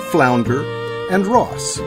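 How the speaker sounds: ordinary talk, nothing marked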